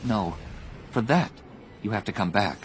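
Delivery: softly voiced plea; quiet, heartfelt parting words; tender farewell. Tearful farewell after a kiss.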